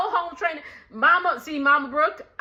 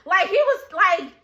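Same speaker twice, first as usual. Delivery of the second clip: ordinary delivery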